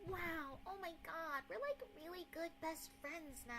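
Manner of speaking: soft, high-pitched gushy V-tuber; fake V-tuber gush. Streamer mocking V-tubers who lie to fans, high gushy voice. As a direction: coo it sweetly like an insincere streamer. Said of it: "one of those V tubers who lies to your face and says".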